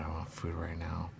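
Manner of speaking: slow, hungry whisper; a soft, longing whisper; whispered craving. Real, not acted. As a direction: whisper your hunger to yourself.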